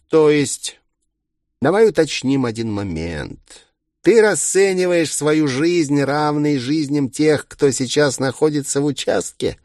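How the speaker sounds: high, needling villain questions; mocking villain. A narrator's reading. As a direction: probe with incredulous scorn, pitched high.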